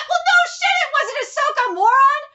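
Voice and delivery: high, loud, mocking shout of disbelief; exasperated yell. Real, not acted. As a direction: yell at the dumb characters. Reader yells at the characters.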